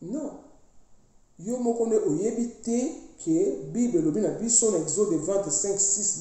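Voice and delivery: ordinary talk, nothing marked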